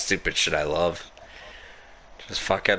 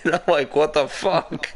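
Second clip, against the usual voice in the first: higher, laughing disbelief; 6 semitones higher than usual. Real, not acted. Telling a drunk friend's antics, laughing. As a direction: say it through laughter.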